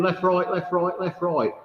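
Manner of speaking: imitating a bawling army sergeant; drill sergeant shouting. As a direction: call the march like a sergeant major. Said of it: "he's shouting and bawling".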